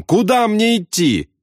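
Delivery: very high, desperate question; cracking-voice yell. A narrator's reading. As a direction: demand directions in a panicked cry. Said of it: "заорал в переговорное устройство Макват срывающимся голосом".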